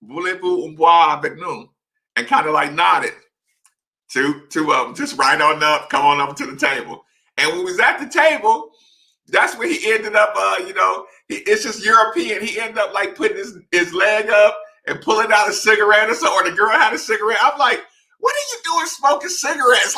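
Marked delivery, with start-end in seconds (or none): 0.0-1.7 attempted French. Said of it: "I said". 18.2-20.0 high, incredulous outburst; shocked disbelief. Retold or imitated. Athlete scolding teammates for smoking, with high incredulous disbelief.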